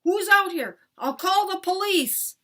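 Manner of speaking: loud, high-pitched alarmed yell. A narrator's reading. Dad running out at a prowler with a bat, yelling. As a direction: shout a challenge at an intruder. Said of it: "He was yelling".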